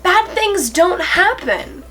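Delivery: high, strained voice on the edge of crying; pushing through rising tears; near tears. Real, not acted. Tearing up while explaining why she cares. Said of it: "I feel like I'm about to start crying".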